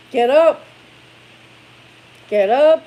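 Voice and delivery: loud, clipped wake-up call; firm call. Real, not acted. Mom ordering her son out of bed. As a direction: bark it to get him moving.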